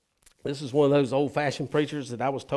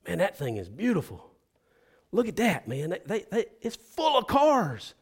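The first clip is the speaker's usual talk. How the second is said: admiring newcomer; 5 semitones higher, 5 dB louder than usual. Retold or imitated. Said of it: "He said".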